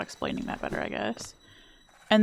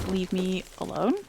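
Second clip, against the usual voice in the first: ordinary talk, nothing marked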